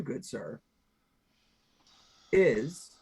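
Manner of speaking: normal talking voice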